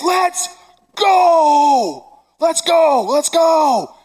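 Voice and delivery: put-on high, loud, shouting like a thrilled kid; excited shout. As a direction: yell it out like an eleven-year-old who can't wait. Kids yelling excitedly.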